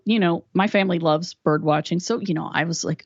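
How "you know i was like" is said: ordinary delivery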